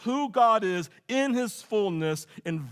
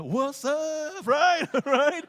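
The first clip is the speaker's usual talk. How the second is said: cocky; 8 semitones higher, 3 dB louder, much slower than usual. Retold or imitated. Cocky kid to bully after rescue.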